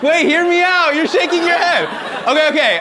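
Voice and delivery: high, loud pleading over laughter; loud protest. Real, not acted. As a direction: cut in high to win them back. Protesting at audience reaction.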